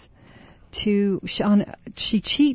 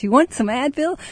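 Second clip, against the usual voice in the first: bright, chirpy offer, a bit higher; beaming voice; 6 semitones higher than usual. Retold or imitated. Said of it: "In this beaming voice".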